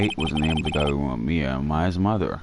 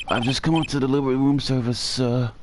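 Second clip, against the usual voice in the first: put-on higher, polite old-fashioned bellboy; Alfred voice; 7 semitones higher than usual. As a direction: play an eager, chirpy butler-type bellhop. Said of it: "So I'm gonna do the Alfred voice".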